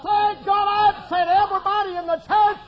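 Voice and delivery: high, exultant pulpit cry; full-force preaching. Real, not acted. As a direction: shout the testimony from the pulpit.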